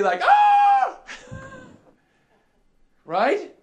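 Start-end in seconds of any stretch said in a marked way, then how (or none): 0.2-1.0 very high, loud mock scream; startled scream. Preacher imitating workers caught by the boss, mock scream. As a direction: shriek in comic fright. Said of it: "And what did they do?".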